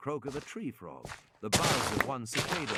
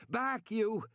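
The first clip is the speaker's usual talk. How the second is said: high, sharp orders; high barked commands; commanding shout; 10 semitones higher, much slower than usual. A narrator's reading. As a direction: drive it back with your voice. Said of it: "Regis shouted".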